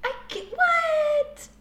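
very high, drawn-out incredulity; stunned disbelief. Real, not acted. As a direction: squeak it out slowly, baffled. Balks at the family question.

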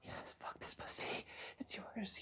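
usual speaking voice

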